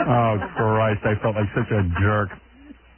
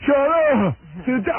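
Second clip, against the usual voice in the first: mock father's yell; 12 semitones higher, slower than usual. Daughter imitating her father shouting. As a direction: mimic dad snapping. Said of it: "you'd be scared of that".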